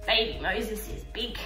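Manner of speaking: normal talking voice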